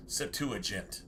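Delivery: Septuagint with a soft J sound. Shown as a demonstration. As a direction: say Septuagint ending in "jint". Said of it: "with a J sound is soft".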